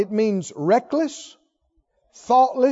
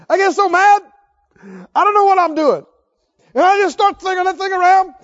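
higher, louder mock fury; acted rage; 7 semitones higher, 5 dB louder than usual. Preacher acting out a rage, high and loud. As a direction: act out losing your temper with the sword. Acting out mad sword swinging.

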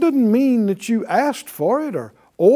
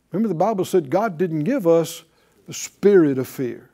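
normal talking voice

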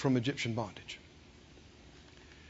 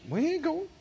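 high, soft mock refusal; quietly balky little voice; acted refusal; 8 semitones higher, 5 dB quieter than usual. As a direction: refuse in a small, raised voice. People refusing God's command.

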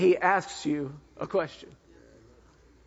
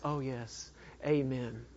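half-hearted amen; 6 semitones lower, 11 dB quieter, slower than usual. Retold or imitated. Mocking churchgoers' lazy amen.

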